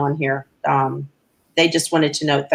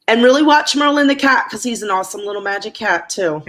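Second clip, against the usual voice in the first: excited; 5 semitones higher, 4 dB louder than usual. Real, not acted. Gushing about the cat.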